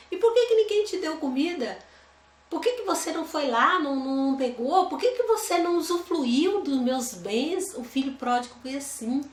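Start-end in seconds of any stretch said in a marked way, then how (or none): 0.1-7.7 questioning father. Acted. Father questioning crying son.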